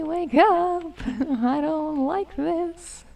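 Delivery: ordinary talk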